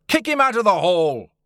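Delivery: loud, high angry yell; acted mob demand; heckler's shout. A narrator's reading. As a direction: shout over a noisy hall. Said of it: "there were shouts of".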